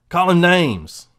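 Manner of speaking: emphatic loud repeat. Shown as a demonstration. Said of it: "how they do it is name calling".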